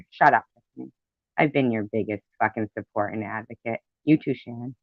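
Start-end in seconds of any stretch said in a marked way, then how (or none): none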